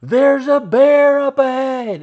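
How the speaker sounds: high, loud and slow warning shout; the trail guide shouting a bear warning to the hikers, loud and slow; guide's urgent yell. Retold or imitated. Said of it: "He yells".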